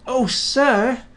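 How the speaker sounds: high delighted exclamation; a proud little engine thanking his boss; happy. A narrator's reading. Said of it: "said Thomas happily".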